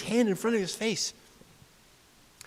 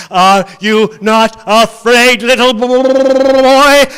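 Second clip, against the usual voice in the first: loud, slow, booming creature voice; monster taunt; 4 semitones higher, 14 dB louder, much slower than usual. Acted. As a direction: loom over the boy and draw out each word. Villain taunts boy in story.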